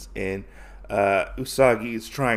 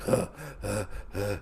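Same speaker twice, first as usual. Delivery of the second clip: soft, mimed out-of-breath puffs; panting; 4 semitones lower, 9 dB quieter than usual. Acted. Gin after fighting the snake, acted panting. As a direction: pant quietly as if winded. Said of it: "Gin's panting".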